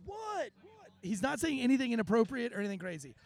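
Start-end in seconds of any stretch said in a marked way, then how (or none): 0.1-0.9 high-pitched, softer imitated protest; mock indignant. Heckler answering fans who told him to shut up, mock indignant. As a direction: protest in a raised pitch, held back in volume. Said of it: "And he's like".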